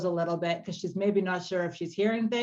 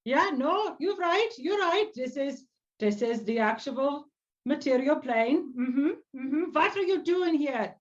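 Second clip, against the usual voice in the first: put-on high-pitched old bear-folk voice; old druid, puzzled; 7 semitones higher than usual. Old druid asks a stranger what she's doing here, puzzled. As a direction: agree haltingly, then question the stranger in a high voice.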